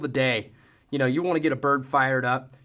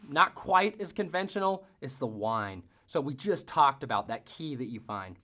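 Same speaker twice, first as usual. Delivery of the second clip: usual speaking voice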